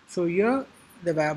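ordinary talk

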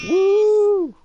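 high loud cheering whoop; excited whoop. Real, not acted. Cheering the sound effect.

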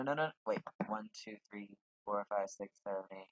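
usual speaking voice

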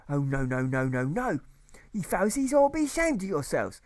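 a pompous master berating his class; ranting old schoolmaster. Acted. Old teacher berating the pupils, ranting high voice.

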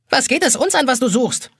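very high, scolding little voice; nagging egg timer. A narrator's reading. Pink egg timer telling off the nosy boy, high scolding. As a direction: nag in a high pitch. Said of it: "keift eine pinkfarbene Eieruhr".

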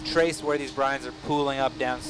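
ordinary talk

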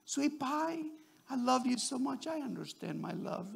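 soft, tender sweet-talk; placating. Acted. Husband sweet-talking his mad wife, placating. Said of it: "don't even say you're mad".

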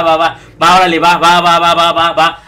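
loud, rapid fed-up okays; a burst of fed-up va va va; heated repeated okays. Retold or imitated. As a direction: bark out angry agreement. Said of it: "Fue como".

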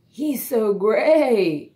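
slow, loud drawn-out horse voice; neighing horse. A narrator's reading. Horse mocking the grey duckling, slow and loud. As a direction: stretch GREY out like a whinny. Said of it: "neighed the horse".

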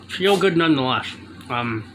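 talking while chewing behind hand; muffled through covering hand; hand over full mouth. Real, not acted. Seen 4: Fist covers mouth throughout while chewing, after eating pretzel bite.

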